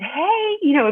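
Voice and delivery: squeaky-high nervous greeting. Retold or imitated. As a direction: voice shoots way up on the greeting. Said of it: "my voice goes up like an octave".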